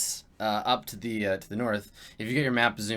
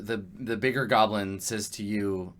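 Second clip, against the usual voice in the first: ordinary talk, nothing marked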